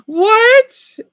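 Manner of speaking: high, loud incredulous what; shocked. Real, not acted. Vlogger baffled by the deer sentence, loud shocked yelp. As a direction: yelp it in disbelief.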